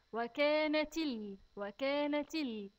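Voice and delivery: وكانت with its vowels slowly stressed; وكانت said slowly and deliberately; stressing the kasra. Shown as a demonstration. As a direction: lean on each vowel of وكانت. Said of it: "خد بالك من الكسر في حرف التاء".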